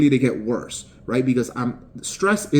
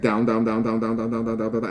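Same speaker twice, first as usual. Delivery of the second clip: each down a bit softer, sinking away; repeated down fading; 3 semitones lower, 4 dB quieter than usual. Shown as a demonstration. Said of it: "said that it was".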